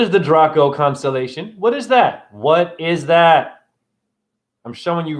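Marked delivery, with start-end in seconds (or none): 1.6-2.3 "What is that?" high and quick; high-pitched question. Real, not acted. Quizzing viewers on the constellation, high quick question. 2.3-3.6 "What is that?" very slow, word by word; slow, emphatic question. Real, not acted. Quizzing viewers on the constellation, slow emphatic repeat.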